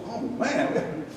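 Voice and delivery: breathy, whispered dread; mock-nervous Moses muttering under his breath; hushed reluctant Moses. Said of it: "And Moses said".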